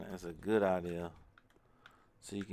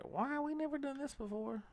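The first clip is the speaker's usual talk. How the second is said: very high, soft falsetto quote; mock questioning; 7 dB quieter than usual. Gamer quoting own reaction to painting, mock falsetto wonder. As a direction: put on a tiny, high-pitched voice. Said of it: "I was like".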